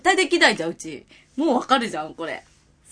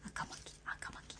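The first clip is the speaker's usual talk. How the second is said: whispering; 27 dB quieter, much slower than usual. Real, not acted. Practicing tongue twister under breath.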